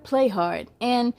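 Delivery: ordinary delivery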